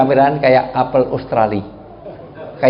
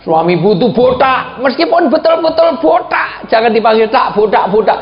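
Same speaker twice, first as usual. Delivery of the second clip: preacher mimicking a wife teasing her bald husband; mock taunt; 8 semitones higher than usual.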